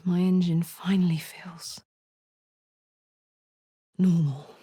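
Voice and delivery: hushed, breathless relief; whisper. Acted. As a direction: let it out as a whisper. Whispered aside.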